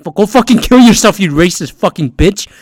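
hammed-up furious outburst; role-played online hater. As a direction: hurl it like an acted-out internet troll. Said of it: "I'm just role-playing here".

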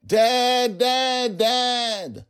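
high, loud panicked screams; frightened child screaming; terrified scream. Acted. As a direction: scream for dad in sheer terror. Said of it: "screamed in a way I've never heard".

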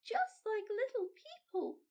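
put-on a small girl marvelling at popcorn; little girl crying out in wonder; excited little girl. A narrator's reading. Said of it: "she cried".

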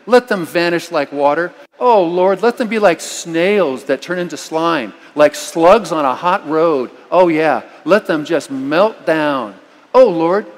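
a preacher's demo of crying out an angry psalm to God, rising to a loud, slow plea; impassioned imprecation. Shown as a demonstration. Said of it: "just read it out loud".

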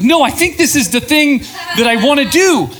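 high, loud, laughing insistence; excited protest. Retold or imitated. To people boxing her in, an excited laughing protest. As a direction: push back half-laughing, near a shout. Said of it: "You're like".

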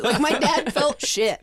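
ordinary delivery

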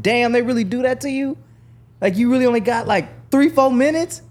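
high, loud amazed disbelief; can't believe how short it is; shocked question. Retold or imitated. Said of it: "And I said".

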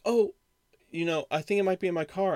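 imitating a high, loud, quick burst of hope; a mock brightened "oh!" that rushes on; sudden hopeful excuse. Clerk imitates the customer remembering her ID in the car, hopeful and quick.

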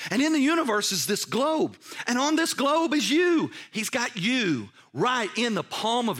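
high, loud, building proclamation; impassioned preaching. Real, not acted. Preacher telling the congregation whose hand holds them, rising fervour. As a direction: climb in pitch and volume to land on the listener.

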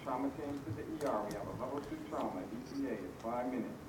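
distant overhead PA announcement; paged over the hospital intercom; hospital page. Real, not acted.

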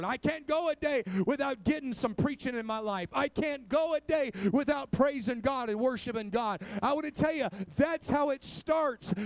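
loud, driving sermon build; full-force preaching. Acted. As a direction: push each line harder to the congregation.

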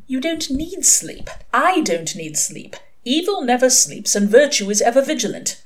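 a hint of a righteous angel; Aziraphale. A narrator's reading. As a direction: lecture mildly as the angel.

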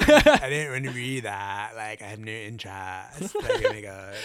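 put-on a giggly valley girl, bored. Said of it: "That's [name]'s Valley Girl".

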